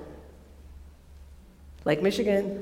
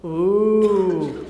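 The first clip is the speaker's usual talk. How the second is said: mock awe; 5 semitones lower than usual.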